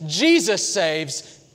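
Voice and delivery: "Jesus saves" shouted very slowly; loud Jesus saves. Acted. Preacher to his congregation, "Jesus saves" loud and slow.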